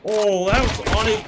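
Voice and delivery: excited. Real, not acted. Near miss shot excitement.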